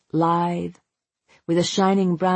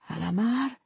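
soft, higher, rising murmur; faint utterance; 5 semitones higher, 6 dB quieter than usual. A narrator's reading. Mother sagging into her husband's arms, faint. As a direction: let one word out weakly, lifting at the end. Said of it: "was all she said".

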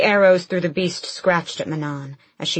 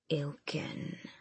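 drawn-out monster hiss; hissing creature; 3 semitones lower, 16 dB quieter than usual. A narrator's reading. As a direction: hiss the word like a serpent. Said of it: "one of them hissed".

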